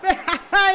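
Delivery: high, loud cackling laugh; gloating laugh. Real, not acted. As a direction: laugh in their faces. (carcajada burlona y fuerte)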